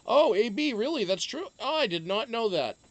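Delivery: real astonishment at the news; surprised. Real, not acted. Host reacting to news in the chat, surprised.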